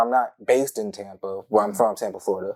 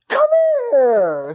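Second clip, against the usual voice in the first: high and louder beckoning call; excited cousin; 6 dB louder than usual. Retold or imitated. Cousin Bridget calling him over, excited high call. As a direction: summon him eagerly. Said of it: "real excited".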